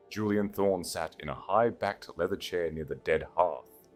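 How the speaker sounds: usual speaking voice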